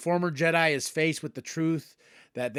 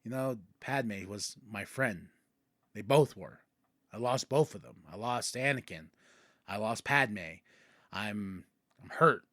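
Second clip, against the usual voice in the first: grieving; 6 semitones lower, 6 dB quieter than usual. Retold or imitated. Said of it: "Ahsoka just has this great".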